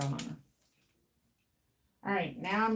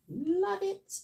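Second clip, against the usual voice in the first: much higher, bright "Love it"; excited; 12 semitones higher than usual. Real, not acted. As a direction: gush it up high. Raving over feathered hair.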